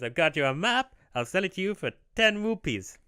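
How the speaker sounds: high-pitched map seller with mock accent; Tingle. Selling a map for rupees, high put-on accent. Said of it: "I don't know if this is how Tingle sounds".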